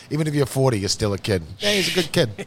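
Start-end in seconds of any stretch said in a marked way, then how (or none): 1.6-2.5 put-on a light laughing Massachusetts accent, higher; a hint of a Boston guy praising someone. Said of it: "You call everybody kid".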